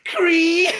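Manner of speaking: high squeaky creak; creaky shutter sound. Pretend. Shutters opening sound effect.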